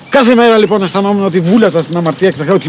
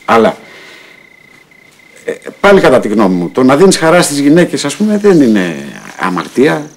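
a sinner talking straight to God, pausing often; earnest prayer; 4 semitones lower than usual. Acted. Prays alone to God.

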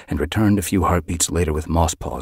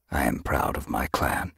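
softer, lower, measured declaration; declaring; 4 semitones lower, 5 dB quieter than usual. A narrator's reading. Leader addressing his clan, solemn declaration. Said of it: "Broken Star declared".

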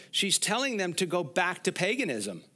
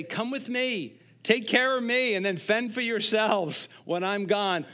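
put-on higher, louder, a needy old woman's plea; mock needy plea; 7 semitones higher, 4 dB louder than usual. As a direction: ham up a clingy demand. Mock selfish plea, not what Naomi said.

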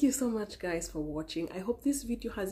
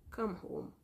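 soft plea; 8 dB quieter than usual. Real, not acted. Pleading gently with the viewer.

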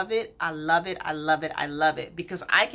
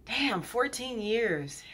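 moved; 4 semitones higher, 5 dB quieter, slower than usual. Real, not acted. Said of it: "I am literally getting emotional".

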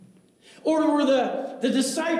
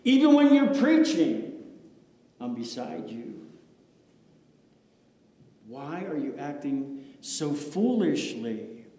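Jesus speaking tenderly; 7 semitones lower than usual. Acted. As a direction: speak gently as Jesus to the pastor. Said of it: "Jesus is talking to me".

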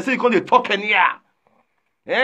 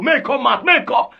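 mock summoning shout; 5 semitones higher than usual. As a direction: holler the summons. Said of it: "when I invite her, say".

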